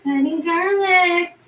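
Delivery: high, slow fake sob; mock crying. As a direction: whimper it like cutting stings. Mock crying while cutting.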